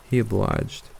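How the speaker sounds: usual speaking voice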